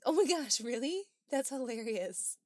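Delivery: loud high surprise; surprised. Real, not acted. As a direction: gasp it out in disbelief. Reacts to surprising chat fact.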